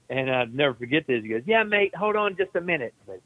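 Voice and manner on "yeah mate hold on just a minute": higher-pitched, put-on Australian voice; Australian accent. Australian medic on the helicopter answering a plea for pain relief, imitated Australian accent. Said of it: "big old straight flag".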